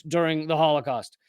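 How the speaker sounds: ordinary talk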